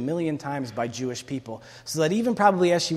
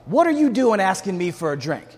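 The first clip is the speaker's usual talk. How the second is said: loud, higher-pitched indignant retort; sharp response; 4 semitones higher, 6 dB louder than usual. Acted. Samaritan woman rebuking a man who asked for a drink, sharp. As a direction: snap back loudly, punching each accusation. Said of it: "Her response was sharp".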